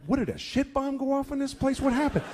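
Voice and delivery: driving through a bleak town, shocked. Retold or imitated. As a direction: gape at the ruined town. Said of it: "you're like".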